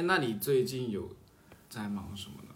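usual speaking voice

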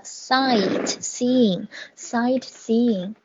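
SIGHTSEEING said slowly, each syllable clear; careful pronunciation. Shown as a demonstration. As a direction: model "sightseeing" slowly for students. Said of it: "有音不太准的".

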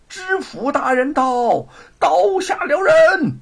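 high, loud, slow drawn-out call; long high call. A narrator's reading. Herald announcing the prefect to halt the execution, high loud call. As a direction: stretch the call out across the square. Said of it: "随着一声高喊".

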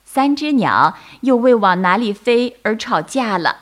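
ordinary delivery, nothing marked

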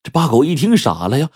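usual speaking voice

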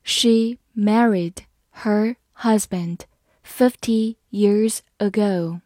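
word by word, slowly; slow, careful model reading for learners. Shown as a demonstration. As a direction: read slowly with pauses between words. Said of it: "慢慢来读".

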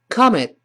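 "come it" as quick "comet", t unreleased; high, short "come it" with no pop; fast reading. Shown as a demonstration. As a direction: hold the breath on the last t of "come it". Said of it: "最后舌头顶住".

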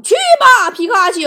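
very high, loud battle cry; shouting. Retold or imitated. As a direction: yell it like a Pokémon trainer. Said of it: "有事儿大喊一声".

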